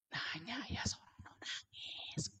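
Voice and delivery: slow, barely-there whisper; hushed, drawn-out murmur to a child; softened voice. Retold or imitated. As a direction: drop right down to a whisper. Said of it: "Jadi suara saya tuh dipelankan".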